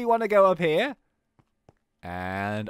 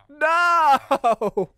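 long, loud, high wail; hammed-up drawn-out scream; mock anguished cry; 10 semitones higher, 9 dB louder than usual. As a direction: howl in theatrical grief. Mourns at the end screen.

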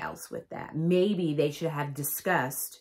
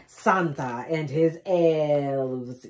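very slow, louder announcement; slow announcer; 4 dB louder, much slower than usual. Pretend. Reading the tour poster, slow announcer.

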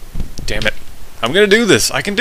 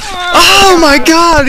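loud, high, drawn-out exclamation; shocked shout; 9 semitones higher, 7 dB louder, slower than usual. Real, not acted. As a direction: burst out in disbelief. Shocked by rider's body tearing.

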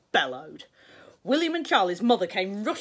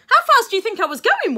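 high, loud eager question; bursting with pride in the crash; excited little boy; 13 dB louder than usual. Acted.

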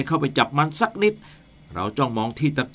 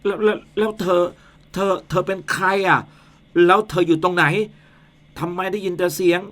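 higher, stuttering frightened voice; scared stammering; 6 semitones higher than usual. Acted. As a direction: stammer in rising panic. Man hears invisible ghost.

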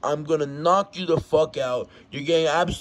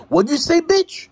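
high, loud comeback; outraged challenge; 13 semitones higher, 6 dB louder than usual. Acted. Fighter challenges the rival who insulted him, outraged. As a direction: fire back in a raised, high-pitched shout.

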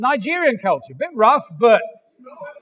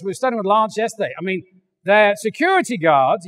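usual speaking voice

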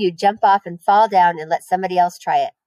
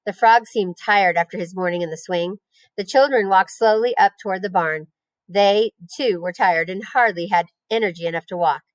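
usual speaking voice

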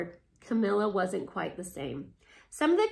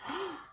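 gasp; 8 semitones higher, 7 dB quieter than usual. Real, not acted. Reader reacts to the transformation.

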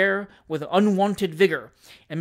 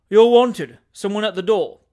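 calling out; 3 semitones higher, 9 dB louder than usual. Acted. Tupman summoning Winkle to door, calling out. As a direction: shout it with sleepy effort.

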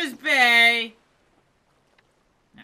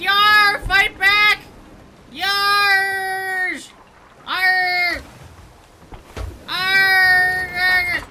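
loud hammed-up pirate yells; 5 semitones higher, 5 dB louder, much slower than usual. Player shouting to his captain in hammed-up pirate talk. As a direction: growl it out like a movie pirate. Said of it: "Why am I talking like that?".

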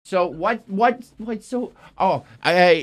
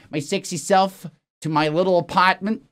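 usual speaking voice

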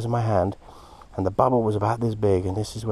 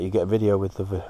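ordinary talk, nothing marked

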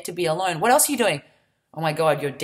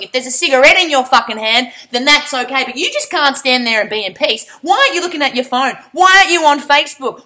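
loud, higher, ranting boss; angry boss; 6 semitones higher, 7 dB louder than usual. Retold or imitated. Boss yelling at an employee to get back in. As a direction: bark at the worker like a fed-up boss. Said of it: "your boss will be like".

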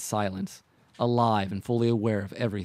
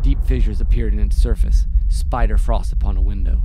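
much louder, then fading back down; swelling narration; 11 dB louder than usual. A narrator's reading. Narrator describes the dome breaking, loud then fading.

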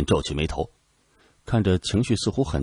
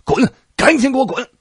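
very high, loud enraged yell; furious shout; 8 dB louder than usual. A narrator's reading. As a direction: scream him out of the tent. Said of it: "蓝大将军暴怒地冲出来".

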